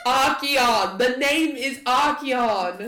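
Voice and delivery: loud, high, drawn-out protest; indignant correction. Acted. Archeon correcting Bartholomew on his name, indignant. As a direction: insist on the name at full volume.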